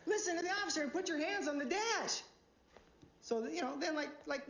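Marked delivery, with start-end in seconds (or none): 0.1-2.2 much higher, frantic imitation of a woman pleading; high-pitched mock female scream; screaming girlfriend. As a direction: mimic a panicked girlfriend shrieking at her man. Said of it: "she starts screaming at him".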